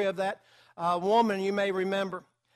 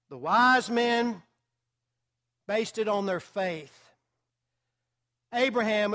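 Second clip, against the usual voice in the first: ordinary talk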